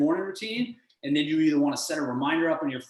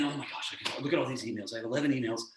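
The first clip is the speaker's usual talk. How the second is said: negative voice; 3 semitones lower, 7 dB quieter, faster than usual. Retold or imitated. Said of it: "In your negative voice".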